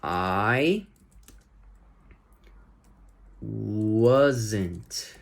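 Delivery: I wasn't, drawn out with a long pause before "wasn't"; slow pronunciation demo. Shown as a demonstration. Teacher modelling pronunciation for students, slow and careful. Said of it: "Deixa eu ajudar com a pronúncia".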